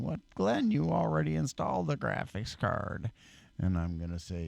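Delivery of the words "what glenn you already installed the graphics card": much higher-pitched mock objection; objecting viewer. Viewer challenging Glenn over the graphics card, mock objection. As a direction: put on a high-pitched questioning viewer. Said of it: "Now you're saying".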